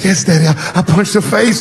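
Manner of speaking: put-on little boy's voice. Son admitting to dad, high boyish voice. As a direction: voice a timid little kid.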